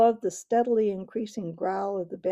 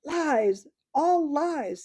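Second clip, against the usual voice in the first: angry denial; 7 semitones higher, 6 dB louder, much slower than usual. Acted. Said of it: "said the Badger".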